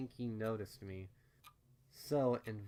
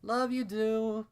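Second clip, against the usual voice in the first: higher, louder, slow loving call; mother calling back; 12 semitones higher, 9 dB louder, slower than usual. A narrator's reading. Mother calling back to her daughter, slow and loving. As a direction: call after your child fondly. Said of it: "her mother called back".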